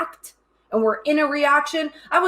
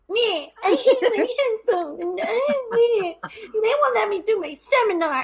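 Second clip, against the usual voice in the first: high-pitched fake-crying whine; mock whining; 11 semitones higher than usual. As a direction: blubber like a sulky child, hammed up. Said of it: "showed up to the call like".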